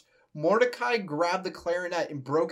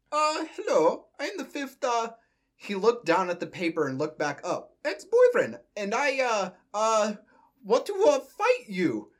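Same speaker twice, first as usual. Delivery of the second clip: high, halting cartoon plumber voice; Luigi; 5 semitones higher than usual. Reading his challenge to Mordecai off paper, as a hesitant Luigi. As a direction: stumble through it high-pitched, with hesitations. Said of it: "Luigi asked".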